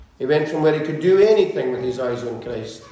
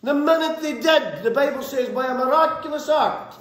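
raised, driving sermon voice at full force; fiery preaching; 10 semitones higher than usual. Acted. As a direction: preach it out to the congregation, pushing higher.

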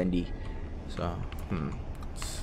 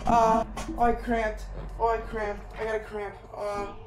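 high pained yelling, fading; screaming in pain; 14 dB louder than usual. Real, not acted. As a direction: cry out through a cramp. Real cramp, shouting in pain.

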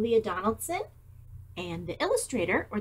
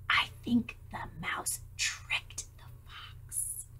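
1 whisper; 9 dB quieter, slower than usual. Real, not acted. Teacher whispers aside.

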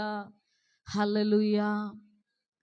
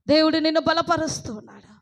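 loud second take; 7 semitones higher, 7 dB louder, faster than usual. Acted. Repeated line, then loud.

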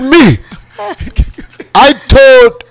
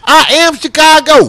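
proud; 10 semitones higher than usual. Real, not acted. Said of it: "proud declaration".

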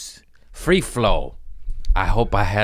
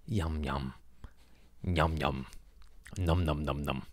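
much softer, lower yumming; yum yum murmur; 6 semitones lower, 12 dB quieter than usual. Pretend. Dreaming of a donut, a soft low yum. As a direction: savor an imaginary glaze.

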